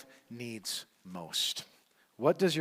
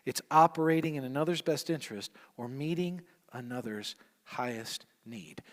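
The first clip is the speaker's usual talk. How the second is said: ordinary talk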